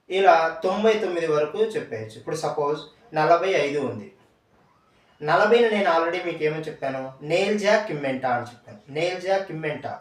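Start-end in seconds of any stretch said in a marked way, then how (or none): none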